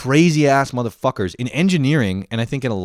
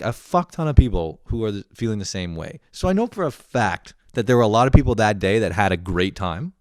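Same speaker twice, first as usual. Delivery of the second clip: normal talking voice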